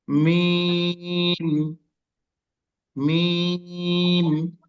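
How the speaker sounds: মিম held very long with lips closing into a hum; demonstrating Meem. Shown as a demonstration. Teacher shows how Meem starts and ends, drawn-out মিম. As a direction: stretch each মিম on one even note. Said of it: "দেখুন".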